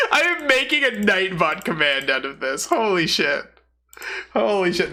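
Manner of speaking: cracking up mid-sentence; laughing. Real, not acted. Streamer cracking up at a clip, laughing.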